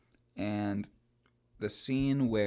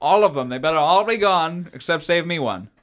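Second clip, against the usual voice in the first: loud, higher gruff order; put-on gruff voice; 6 semitones higher, 13 dB louder than usual. Host slips into a loud gruff character voice. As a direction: boss the kids like a tough smuggler. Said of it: "Why am I talking like this?".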